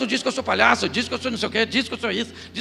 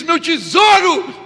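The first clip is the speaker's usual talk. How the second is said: mock tender; 6 semitones higher, 8 dB louder, much slower than usual. Parent cooing to child.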